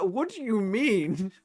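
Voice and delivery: high, slow incredulous question; flabbergasted. Retold or imitated. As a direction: draw it out in high-pitched disbelief. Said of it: "I was just flabbergasted".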